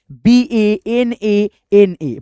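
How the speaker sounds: letter by letter, like dictating to a class; slow spelled letters. Shown as a demonstration. Teacher spelling banana slowly for students. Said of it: "तो केला का क्या होता है".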